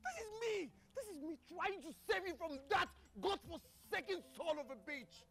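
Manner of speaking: heated, high-pitched defiance; insistent refusal. Acted. Refusing to let someone be, heated and insistent.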